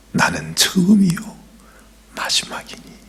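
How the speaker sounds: soft whispered declaration; hushed and reverent; solemn whisper. Acted. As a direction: let the words fade to breath. Whispered quote of Jesus.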